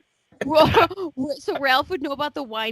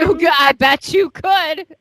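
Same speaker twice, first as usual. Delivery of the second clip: loud, slow, higher-pitched lusty tease; lustful, giddy; 5 semitones higher, 8 dB louder than usual. Real, not acted. Teasing the caller who imagines whining, lustful and giddy. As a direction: draw it out with giddy, eager lust. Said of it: "do you hear the lust in his voice?".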